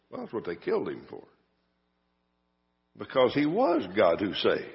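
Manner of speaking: usual speaking voice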